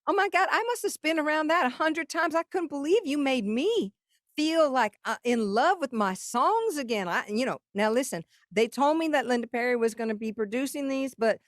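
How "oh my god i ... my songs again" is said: high, breathless gushing Dolly impression, well above own voice; imitating [name]. Telling how Dolly raved about the songs, high and gushing. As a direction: gush like a thrilled Southern star. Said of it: "And she's like".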